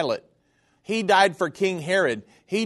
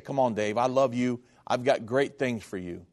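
softer and lower, gently reassuring; tender voice of God; 5 semitones lower, 5 dB quieter than usual. Retold or imitated. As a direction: speak it like a loving father comforting. God speaking tenderly to Dave.

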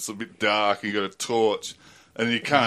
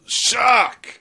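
high, loud shout of alarm; retold yell; 12 semitones higher, 5 dB louder than usual. Someone on the reef yelling a warning, loud high shout. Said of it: "someone yells".

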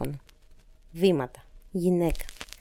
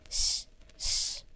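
soft drawn-out shush; 11 dB quieter than usual. Acted. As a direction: hush the child quietly. Woman in script hushes the child. (σιγανό σσσ για ησυχία)

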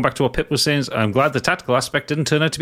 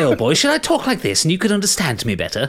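posh voice; 5 semitones higher than usual. Retold or imitated. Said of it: "I say".

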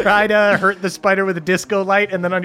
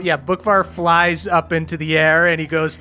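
ordinary talk, nothing marked

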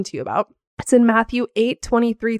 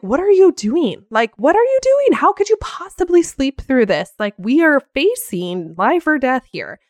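high, loud, exasperated outburst; acted-out anger; 5 semitones higher, 4 dB louder than usual. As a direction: confront them in mock outrage, voice leaping up. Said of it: "I am so mad at Jesus".

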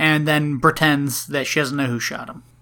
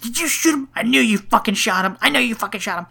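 mock yelling; 6 semitones higher, 3 dB louder, faster than usual. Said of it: "he's, like, yelling at this cop".

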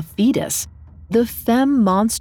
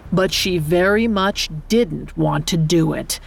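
usual speaking voice

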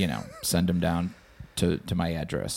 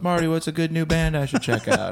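higher, eager fan voice; fan asking; 9 semitones higher than usual. Retold or imitated. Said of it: "someone goes".

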